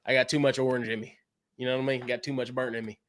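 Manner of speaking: ordinary talk, nothing marked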